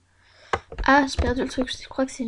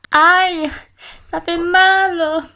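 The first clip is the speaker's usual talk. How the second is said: loud, higher, drawn-out mock ouch; playful cry of pain; 5 semitones higher, 9 dB louder, much slower than usual. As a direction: wail it out loudly, hammed up. Cries out in pain, maybe playful.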